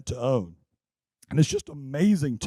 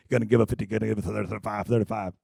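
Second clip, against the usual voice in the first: auctioneer; 4 semitones lower, faster than usual. Pretend. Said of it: "I feel like an auctioneer".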